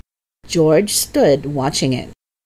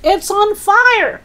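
slow, very high alarm cry; yelling; slower than usual. Acted. Grandma spots the hen smoking, high yell. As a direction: holler the alarm, stretched and high. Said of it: "Grandma yelled".

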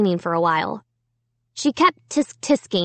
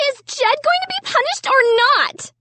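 very high, shrill voice; shrill demand. A narrator's reading. Sister wants brother punished, shrill. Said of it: "Sarah demanded in a shrill voice".

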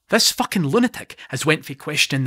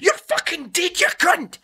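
much higher, raging threat; furious scream; slower than usual. Acted. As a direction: scream it at the man who insulted your child. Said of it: "he's screaming".

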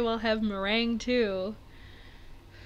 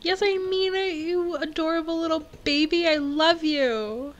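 adoring gush; 6 semitones higher, 4 dB louder than usual. Real, not acted. Player to villager she invited to her island, adoring gush. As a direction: coo it up high, slowing on the last words.

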